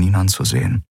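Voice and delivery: plain reading voice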